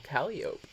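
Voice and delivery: Calliope as KAL-ee-ope; Calliope without final ee. Shown as a demonstration. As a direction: end Calliope like "rope". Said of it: "I just don't recall".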